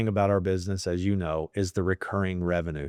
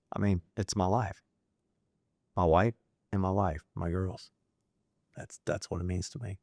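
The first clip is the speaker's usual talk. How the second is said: choked up; 3 dB quieter than usual. Real, not acted. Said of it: "You wanna make me cry".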